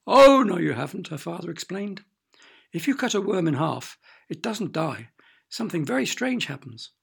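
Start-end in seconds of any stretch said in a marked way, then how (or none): 0.1-1.1 pantomime-style rebuttal; emphatic contradiction. A narrator's reading. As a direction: top the child's protest with a big OH. Father tops daughter in back-and-forth.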